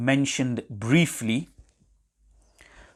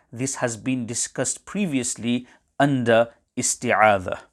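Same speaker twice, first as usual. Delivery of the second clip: ordinary talk, nothing marked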